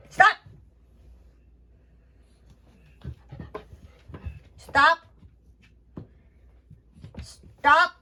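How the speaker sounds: stern stop to a biting pup; loud correcting tone for a dog; firm loud command. Real, not acted. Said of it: "I'm saying it loud so he can understand".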